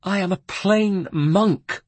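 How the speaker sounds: high, slow, tearful plea; a weeping friar pleading; wept. A narrator's reading. The Carmelite weeps to the guard, high and slow. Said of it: "wept the Carmelite".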